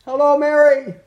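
called out high and loud to reach her. Real, not acted. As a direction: call her name across the room. No answer, calls again louder.

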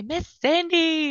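put-on a child happily greeting her at the door; child calling out.

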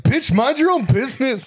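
high-pitched mock outrage; mock indignant retort. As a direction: play an affronted dad, hammed-up. Acting out the dad snapping back.

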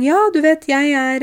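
bright high chatty voice; eager stranger. Retold or imitated. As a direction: play a keen stranger's greeting, pitched high. Retold teacher introducing herself.

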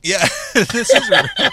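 laughing through the words, pitch high. Real, not acted. Laughing at spicy food.

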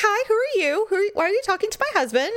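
high-pitched, light retold challenge; friendly aggressive. Wife greets the woman talking to her husband, friendly aggressive. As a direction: imitate a nosy wife, brisk but not hostile. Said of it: "she was being friendly aggressive".

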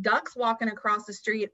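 ordinary talk, nothing marked

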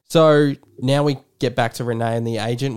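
ordinary delivery

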